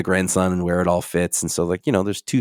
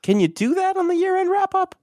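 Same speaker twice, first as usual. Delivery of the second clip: mock indignant. Real, not acted.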